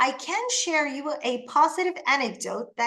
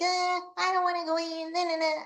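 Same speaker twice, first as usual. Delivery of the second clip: high, whiny kid's refusal, mildly played; imitating a whiny little boy; 6 semitones higher than usual. Narrator mimics the younger boy refusing to enter, whiny. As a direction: pout like a small boy who won't go in. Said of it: "little boys started like".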